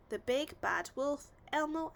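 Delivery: ordinary delivery, nothing marked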